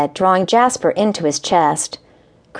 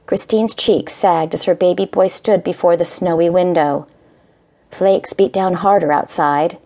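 usual speaking voice

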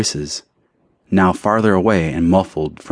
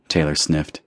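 usual speaking voice

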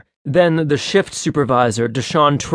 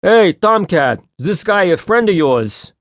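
higher, louder call across the garage; Vinny calls across the garage, loud and high; calling over; 6 semitones higher, 4 dB louder than usual. A narrator's reading. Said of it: "Vinny called over".

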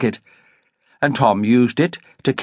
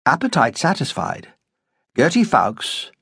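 usual speaking voice

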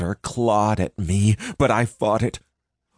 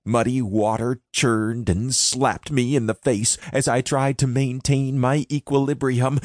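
usual speaking voice

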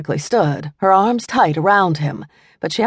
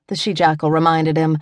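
usual speaking voice

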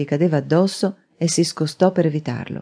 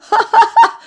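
high, loud burst of laughter; loud, high-pitched guffaw; uproarious laugh; 8 dB louder than usual. A narrator's reading. Man finding his foe's wax chin, uproarious laugh. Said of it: "lo fece sbellicar dalle risa".

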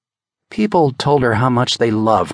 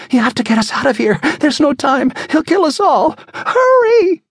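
fast, high, building to a loud scream; frantic urging; 4 dB louder than usual. Acted. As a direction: rush her, panic climbing. Woman urging escape in panic.

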